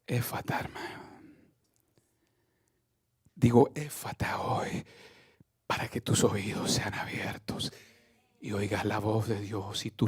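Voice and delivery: soft whispered prayer over the listener; whispered blessing. Acted. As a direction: whisper it like a healing secret. Preacher whispers healing word.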